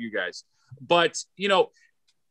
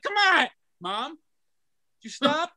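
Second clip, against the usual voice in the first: high, drawn-out mock plea; retold exasperation; 8 semitones higher, slower than usual. Comic retells begging mom to stop, mock exasperated. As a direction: plead with your mother in jest. Retold pleading with his mother.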